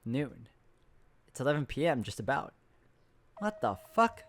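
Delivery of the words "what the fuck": high, startled burst of disbelief; blurting out in sudden shock. Acted. Narrator realising the day has vanished, shocked.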